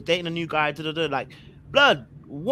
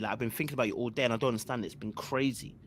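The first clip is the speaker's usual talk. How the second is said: ordinary delivery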